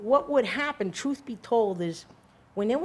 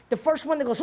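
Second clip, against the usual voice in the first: ordinary talk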